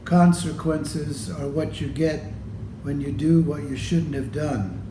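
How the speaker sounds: ordinary delivery, nothing marked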